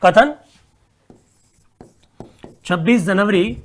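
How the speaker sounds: dictating word by word with long pauses. Acted. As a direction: read the question out for students to write. Dictates a question slowly.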